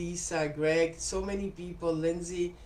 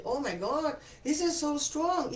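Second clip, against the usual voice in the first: amazed customer; 9 semitones higher than usual. Retold or imitated. Customer opening a strong oil bottle, mock amazed. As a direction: imitate a customer stunned by the scent. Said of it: "and I hear people say".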